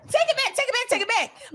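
high, playful take it back; excited protest. Real, not acted. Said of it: "No, no, no".

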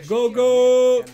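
loud, high mock battle cry; imitating battle cry. Streamer imitating the Celtic war cry, loud and high. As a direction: chant it like the game's war shout. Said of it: "Te motiva a ir a la batalla".

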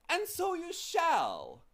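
very high, slow announcer voice; grand dodecahedron. Acted. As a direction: proclaim it with pomp.

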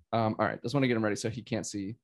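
ordinary talk, nothing marked

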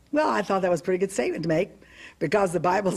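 ordinary talk

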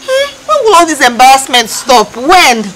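loud, indignant outburst; outraged. Acted. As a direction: cry out in exasperation. Outrage at mother's humiliation.

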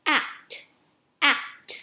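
"Act" with the final T clearly released; short "Act", crisp audible T at the end; popped final T. Shown as a demonstration. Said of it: "mình sẽ bật âm tờ lên".

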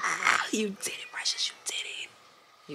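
breathy self-praising whisper; tender whispered pep talk. Real, not acted. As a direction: whisper it to yourself, slow and soft. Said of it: "talking to herself in a whisper".

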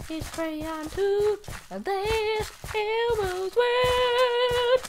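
high, slow Elmo sing-song; Elmo impression. Gamer trying an Elmo impression, high sing-song. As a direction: sing it like a cheery puppet, high. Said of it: "I'll try the Elmo".

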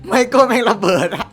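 bursting into laughter mid-line; laughing hard. Real, not acted. Gamer laughing at a teammate blowing up. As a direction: crack up as the thing explodes.

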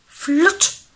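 loud, high sound word; punchy storybook sound effect; sudden exclamation. A narrator's reading. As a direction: burst out with it like a sudden slip.

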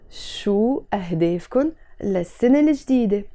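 slow, word-by-word pronunciation for learners. Shown as a demonstration. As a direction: space out each phrase carefully. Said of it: "Let's say it slower".

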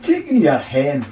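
put-on wise old sage.

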